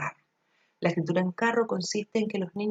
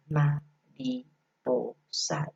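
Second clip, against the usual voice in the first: "mariposa" split very slowly into ma-ri-po-sa; slow syllable split; 3 semitones lower, much slower than usual. Shown as a demonstration. Showing teachers how to segment syllables: "mariposa" stretched syllable by syllable. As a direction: clap out each syllable of "mariposa" for the kids. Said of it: "separarla según sus sonidos".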